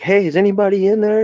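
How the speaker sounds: high, loud call into the rubble. Retold or imitated. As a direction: shout to find anyone inside. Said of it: "we're like".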